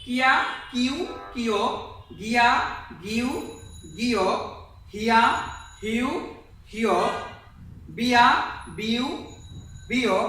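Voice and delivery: each item from কিয়া to রিও sounded out separately; pronunciation drill. Shown as a demonstration. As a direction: recite কিয়া to রিও one by one with pauses. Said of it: "একবার উচ্চারণ করে দিচ্ছি".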